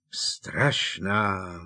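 put-on sleepy drunk mumble; mumbled. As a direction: slur it out, half asleep. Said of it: "drumlal Hagrid". (zamumlané)

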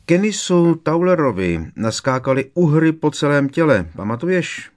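high, loud, delighted reminiscing; relishing memories. A narrator's reading. Fred reminding George of a classmate's misery, relishing it. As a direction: revel in it, pitched well up. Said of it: "Kochal se vzpomínkami Fred".

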